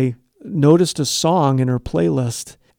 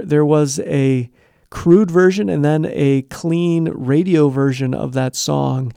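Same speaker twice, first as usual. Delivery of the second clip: ordinary talk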